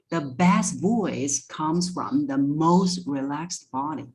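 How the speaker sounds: extra breath on "best" and "most", slowly drawn out; breathy emphasis on key words. Shown as a demonstration. Coach showing breath on key words, slow breathy stress on "best" and "most". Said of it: "putting more breath on the word best and most".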